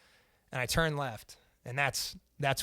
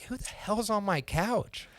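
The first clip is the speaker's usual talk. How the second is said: put-on slow, startled girlfriend; 6 semitones higher, 6 dB quieter, slower than usual. Girlfriend finds stranger on couch.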